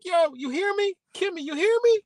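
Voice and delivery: very high calling voice. Pretend. Calling to Kimmy far away, very high pitched. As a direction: call out to her, straining high. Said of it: "I'm like".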